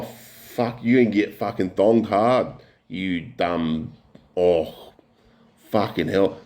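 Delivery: ordinary talk, nothing marked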